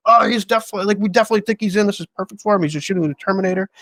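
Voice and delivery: retold confident promise; mock upbeat agents. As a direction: sell it like a cheerful agent. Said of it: "his agents were like".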